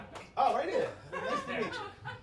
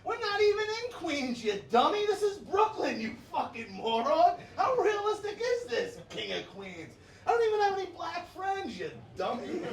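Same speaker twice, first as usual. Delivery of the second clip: exasperated mock tirade; 7 semitones higher, 4 dB louder than usual. Comic correcting a kid's mistake, high exasperated mock rant. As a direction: berate the kid in mock outrage. Acted scolding of mistaken kid.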